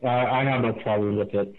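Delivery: hollow, muffled phone line; like talking through a toilet paper roll; muffled through a tube. Real, not acted. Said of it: "You sound like you're talking through a, the, the center of a toilet paper roll".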